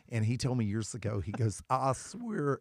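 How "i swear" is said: imitating the Knoxville friend, S stretched long; long drawn-out S. Imitating a Tennessee friend, lightly put-on Southern drawl on the S. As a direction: drag out the S in "swear". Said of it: "his S's take about a year and a half".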